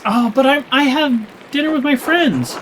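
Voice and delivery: high, pleading excuse; a high, whiny plea to skip supper. Pretend. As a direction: squeak out a flimsy excuse to dodge dinner. Dodging supper with the nobles.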